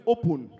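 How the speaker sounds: "open" said as "oh-poon"; rounded second vowel in "open"; Nigerian pronunciation. Shown as a demonstration. Comedian shows Kenyans the Nigerian way, "open" as "oh-poon". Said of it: "in Nigeria we call it".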